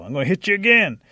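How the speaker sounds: high, loud acted threat; shouted threat. A narrator's reading. Rabbit with one hand stuck, shouting a threat.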